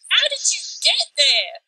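utterly baffled, pitch lifted; incredulous. Acted. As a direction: demand to know, in mock disbelief. Baffled at fanfic interpretations.

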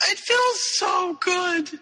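high, drawn-out blissful squeal; giddy, slow and high, hammed-up bliss; euphoric. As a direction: stretch it out in high-pitched acted euphoria. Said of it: "Her elation and euphoria".

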